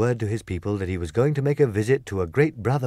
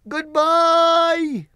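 wizard's farewell; 6 dB louder than usual. Acted. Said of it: "called Oz from the basket".

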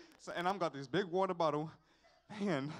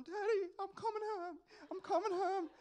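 much higher, pleading relief; relieved call to dad; 11 semitones higher than usual. Retold or imitated. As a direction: call out to dad like a rescued child. Lost child calling his dad.

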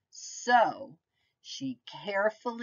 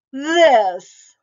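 high, loud monster reveal; loud germ reveal; 6 semitones higher, 13 dB louder than usual. Acted. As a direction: burst out like a monster.

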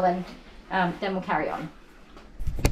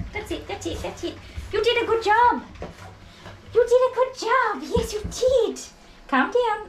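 high excited dog praise; 13 semitones higher than usual. Real, not acted. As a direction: squeal the praise up high like to a puppy. Praising excited dog while grooming.

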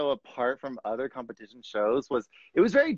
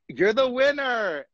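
higher, slower, louder host-style reveal; retold winner announcement; 10 semitones higher, 6 dB louder, slower than usual. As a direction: announce it slowly like a show host naming the winner. Retold moment of being crowned winner.

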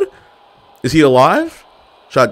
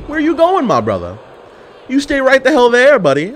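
taunting; 12 semitones higher than usual. Real, not acted. Taunting opponent in game.

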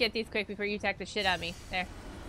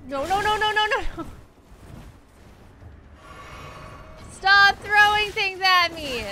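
panicked pleading; 8 semitones higher, 6 dB louder than usual. Real, not acted. Being attacked.

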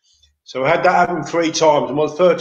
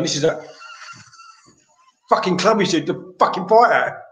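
agitated; 5 semitones higher than usual. Retold or imitated. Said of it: "got a bit agitated".

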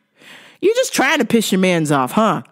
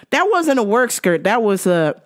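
normal talking voice

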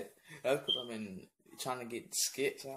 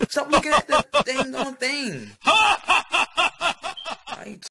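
very high, very loud outburst; furious yelling; 16 dB louder, much slower than usual. Acted. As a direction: blow up at whoever won't stop filming. Acted quarrel escalates into yelling.

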